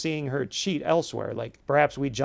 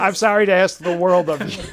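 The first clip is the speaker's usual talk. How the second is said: louder, words broken by real laughter; laughing; 5 semitones higher, 9 dB louder than usual. Real, not acted. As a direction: laugh through the apology. Both laughing at evasive answer.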